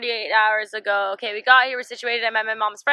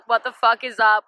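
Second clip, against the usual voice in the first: ordinary talk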